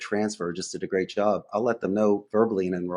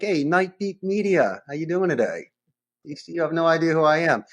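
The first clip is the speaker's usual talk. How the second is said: strong opening pitch; 6 semitones higher, 4 dB louder than usual. Shown as a demonstration. Salesperson opening a cold call, energetic and high. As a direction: come in strong and bright on the company name. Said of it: "start strong".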